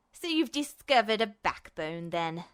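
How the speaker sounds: low, disgusted, dropping to a near mutter; snarling villain. A narrator's reading. Justin sneers at Ed, low and disgusted. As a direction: sneer it down at him. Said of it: "Justin nearly snarled in disgust".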